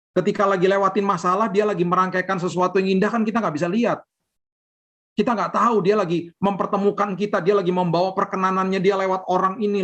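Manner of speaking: impassioned sermon climax, pitched high; fervent preaching. Acted. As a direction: lift the congregation with growing passion. Preaching rises to full force.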